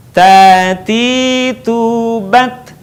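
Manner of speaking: long vowels in "Tati tubat", a puff of air on the t; airy T. Shown as a demonstration. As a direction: chant "Tati tubat" slowly, letting air flow on each T. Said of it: "ada udara yang mengalir". ("Tati tubat" panjang, huruf t dialiri napas)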